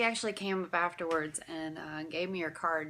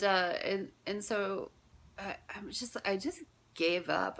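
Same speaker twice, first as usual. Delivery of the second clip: usual speaking voice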